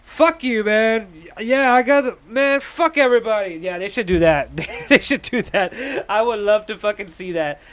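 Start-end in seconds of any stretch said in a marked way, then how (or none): 0.0-3.5 high, agitated washed-up superhero; hammed-up wasted hero griping at the world; down-and-out Spider-Man. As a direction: play a hero who's hit rock bottom, pitched up. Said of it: "down and out Spider-Man".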